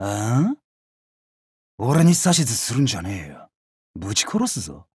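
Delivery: low, quiet menace; menacing threat. Acted. Warrior threatening someone who orders him around, low menace. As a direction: warn them off in a dangerously low voice.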